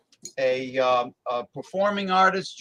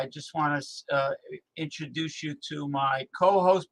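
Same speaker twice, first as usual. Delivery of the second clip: ordinary talk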